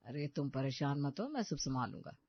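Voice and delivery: low, soft reassurance; calm husband taking over; reassuring husband. A narrator's reading. Husband reassures his wife.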